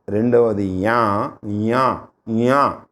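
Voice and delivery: slow repeated యా through the nose; nasal palatal demo. Shown as a demonstration. Teacher drilling the nasal palatal యా for students. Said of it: "ముక్కు కూడా కలపాలి".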